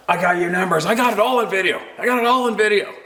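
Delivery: irate canoe guy threatening; mock angry stranger. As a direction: imitate him waving his video.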